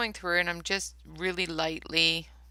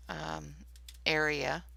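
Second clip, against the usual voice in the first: ordinary talk, nothing marked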